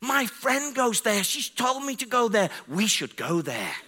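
excited high-pitched girl's voice, imitated; other girl. Other girl to her friend about the church, excited. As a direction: pipe up high and eager, dropping at the end. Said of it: "The other girl says".

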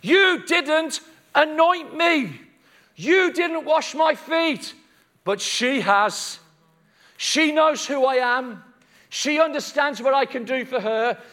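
slow, high, emphatic reproach; ringing, deliberate accusation; Jesus rebuking Simon. Acted. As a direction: call him out point by point. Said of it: "And he says".

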